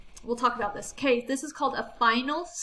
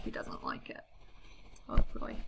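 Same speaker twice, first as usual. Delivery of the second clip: "He doesn't like it" whispered softly; whispered aside; 10 dB quieter than usual. Real, not acted. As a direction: murmur it as a hushed comment.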